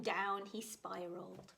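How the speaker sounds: ordinary delivery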